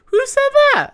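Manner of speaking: very high, loud mimicked demand. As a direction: squeal it as a loud reality-TV callout.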